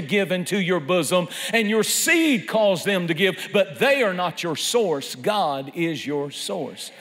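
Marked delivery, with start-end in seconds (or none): none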